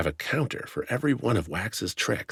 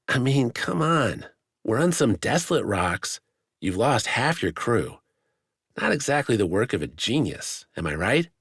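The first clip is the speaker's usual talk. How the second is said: needling taunt; 6 semitones higher than usual. A narrator's reading. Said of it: "the needling making its magic".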